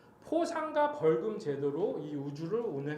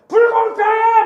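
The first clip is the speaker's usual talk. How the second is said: high, loud cry of injustice; full-voiced shout; 16 dB louder, slower than usual. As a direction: yell it out as if alone in the rain. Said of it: "소리 지르면서". (억울함에 목청껏 외치기)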